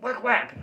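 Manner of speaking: high, loud double hit noise; whack sound effects. Retold or imitated. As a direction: punch it out twice like a cartoon. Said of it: "they're like".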